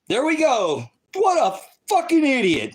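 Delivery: high, loud scornful yell; high-pitched jeering outburst; mocking shout. Real, not acted. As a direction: blast the insult out in mock disbelief. Mocking a commenter's question.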